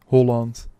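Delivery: ordinary talk, nothing marked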